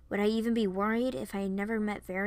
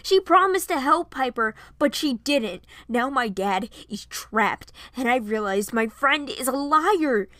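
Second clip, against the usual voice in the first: louder, raised voice building to the end; angry accusation; 4 semitones higher, 8 dB louder than usual. A narrator's reading. Varian blames his friend for his trapped dad, angry. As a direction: accuse her with hurt force. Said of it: "clenched his fist in anger".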